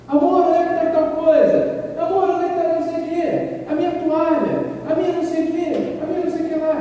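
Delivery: high, loud flustered calling for lost things; frantic calling. Retold or imitated. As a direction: call across the house in a packing panic. Stressed spouse packing, calling out. (esposa estressada gritando para o marido enquanto arruma a mala)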